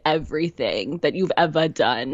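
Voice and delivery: low, scornful inner critic voice; Bitchy Brenda. Retold or imitated. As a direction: sneer it like a cruel inner voice. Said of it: "your Bitchy Brenda may sound different".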